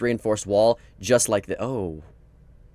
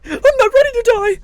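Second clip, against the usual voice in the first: dramatic dying plea; 9 dB louder than usual. Acted. Dramatic fake death plea.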